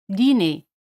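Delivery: "Dîner" ending on a plain e, no r; French dîner. Shown as a demonstration. Teacher models "Dîner" for Spanish speakers. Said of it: "R no se pronuncia".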